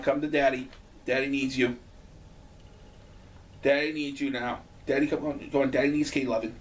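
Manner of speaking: low, soft coaxing; coaxing the cat. Real, not acted. Owner coaxing his cat onto his lap, soft and coaxing. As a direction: sweet-talk a pet to come back.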